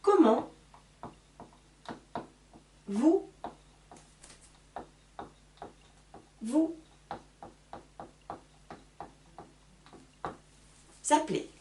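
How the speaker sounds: each word set apart with long pauses; slow dictation. Shown as a demonstration. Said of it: "Je vous écris la question".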